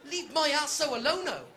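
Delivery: high, loud indignant protest; indignant yelp. Retold or imitated. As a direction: protest through a laugh. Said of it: "I went".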